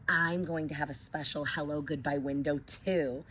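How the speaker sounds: plain reading voice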